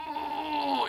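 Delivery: high, drawn-out mock groan of pain. Acting out lying in bed in total anxiety, pained moan. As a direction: moan on one long high note, acting out anguish. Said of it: "in physical pain".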